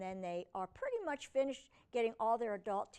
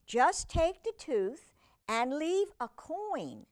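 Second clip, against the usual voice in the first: a slow, higher, louder fairy voice; Tooth Fairy's instruction; 6 semitones higher, 4 dB louder, slower than usual. Acted. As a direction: give the order kindly, a touch sing-song. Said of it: "she says".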